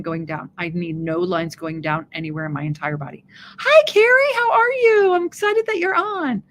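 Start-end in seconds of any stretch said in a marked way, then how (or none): 3.6-6.4 high, bright and loud hello to a viewer; excited greeting. Real, not acted. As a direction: sing out the welcome high and loud. Greeting a viewer who joined.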